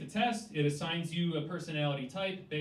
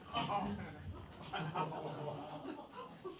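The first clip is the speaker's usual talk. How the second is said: laugh; 4 semitones higher, 10 dB quieter than usual. Real, not acted. After reading the joke.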